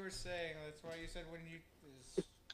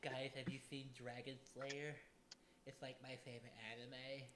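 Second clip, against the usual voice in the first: nerd voice; 3 semitones lower, 11 dB quieter than usual. Pretend. Mocking anime nerd voice.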